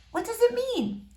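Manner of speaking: put-on shy girl greeting a boy she likes.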